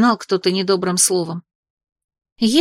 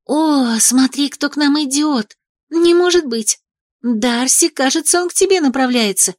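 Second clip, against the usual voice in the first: loud excited whisper; 5 semitones higher than usual. Acted. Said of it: "перешла на громкий шёпот".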